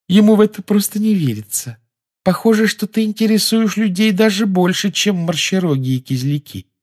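put-on high, airy girlish voice; a dreamy schoolgirl. A narrator's reading. Luna continues quote. (мечтательный девичий голос)

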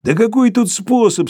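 high-pitched tipsy loquacity; talkative after vodka. A narrator's reading. Sharikov explains himself after vodka, growing talkative. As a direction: open up chattily, pitched well up. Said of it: "становясь словоохотливее после водки".

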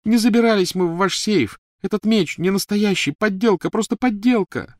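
high, loud tearful pleading; sobbing plea. A narrator's reading. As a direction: beg through sobs. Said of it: "рыдала Гермиона".

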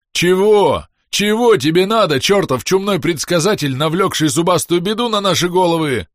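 высокий громкий завывающий голос; выть сверху, как нечисть; нечеловеческий вой. Acted. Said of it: "завыл с потолка нечеловеческим голосом Клест". (нечеловеческий вой)

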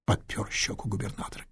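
plain reading voice

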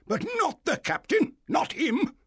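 indignant high shout; high, furious protest; angry. A narrator's reading. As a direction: refuse loudly, like a blustering squire. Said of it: "said the squire angrily".

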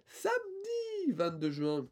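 high squeaky voice; high-pitched voice. Pretend. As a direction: say it in a high little voice. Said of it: "pourquoi une voix aiguë".

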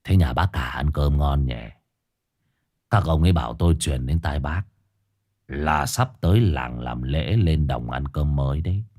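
put-on low, slurred drunken thug. A narrator's reading. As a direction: slur it like a drunk bully. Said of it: "giọng lè nhè bảo bác Cảo Anh".

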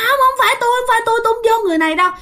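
very high, very fast panicked no; high rushed panicked protest; frantic refusal. Retold or imitated. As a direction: shriek it out, refusing in terror. Grandma refuses to re-enter body.

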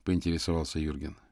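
plain reading voice

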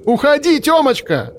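desperate high cry; screaming. A narrator's reading. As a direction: shriek his name, pleading. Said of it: "закричала она".

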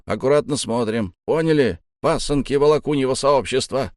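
high, forceful orders to the crew; emphatic commanding sailor voice; bossy crew leader. A narrator's reading. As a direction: order the sailors about, pitched well up.

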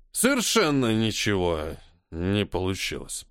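put-on slow slurred drunk voice. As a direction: drag it out, tipsy. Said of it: "пьяно помотал головой Маринер".

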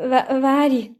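high, loud stammered plea; anxious little girl's "wait"; urgent calling. A narrator's reading. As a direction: call after them, faltering on the first word. Princess calls after departing men.

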